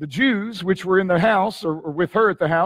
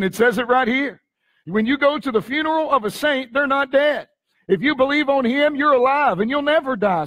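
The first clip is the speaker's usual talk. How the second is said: high, insistent preacher delivery; raised-voice preaching; 6 semitones higher than usual. Real, not acted. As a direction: press it home to the congregation in a high voice.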